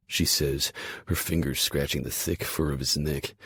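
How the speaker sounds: ordinary delivery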